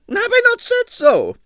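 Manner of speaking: high, loud imperious retort; indignant ballerina. A narrator's reading. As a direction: snap it back imperiously. Arienskaya indignant.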